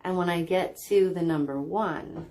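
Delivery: calm, measured relaxation voice with long pauses; guided meditation. Real, not acted. Guide leading listeners through belly breathing to relax, calm meditation voice. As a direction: guide them gently into deep, even breathing.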